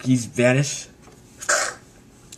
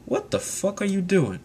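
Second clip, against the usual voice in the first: higher-pitched, soft incredulous question; baffled challenge; 6 semitones higher, 6 dB quieter than usual. Retold or imitated. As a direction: pitch it up in mock disbelief. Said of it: "He's like".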